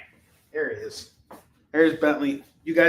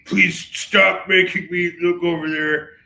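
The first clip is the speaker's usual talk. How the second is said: voicing the dog; 4 dB louder than usual. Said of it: "He's like".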